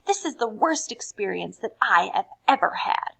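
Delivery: a touch of angry fuming. Acted. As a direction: fume a little. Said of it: "She fumed".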